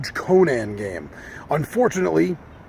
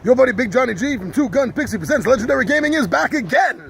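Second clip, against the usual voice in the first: high-energy, higher-pitched show opener; hyped channel intro; 6 semitones higher than usual. Acted. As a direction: announce yourself like a pumped-up host.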